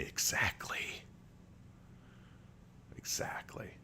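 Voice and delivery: hushed, very soft whisper. Real, not acted. Streamer agreeing that woman in armor is hot, in a whisper. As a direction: whisper it slowly and conspiratorially.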